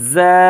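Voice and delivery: "জা" with buzzed z and lengthened vowel; buzzy, held "জা"; one-beat stretch. Shown as a demonstration. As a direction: draw "জা" out as zaa. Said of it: "এক আলিফ টান".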